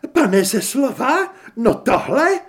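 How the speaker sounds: high, loud, drawn-out lady's cry; outraged lady character; scandalized exclamation. A narrator's reading. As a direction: exclaim in shocked high pitch. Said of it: "zvolala paní Arkánová".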